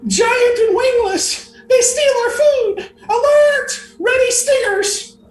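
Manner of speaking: very high, loud bee war cry; rally the hive in a tiny shriek; small yelling bee. Acted. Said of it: "you hear this small voice yelling".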